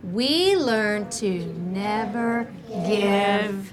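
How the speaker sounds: very slow, stretched words; slow choral reading. Shown as a demonstration. Teacher rereads with the class, slow and drawn-out. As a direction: read word by word for followers.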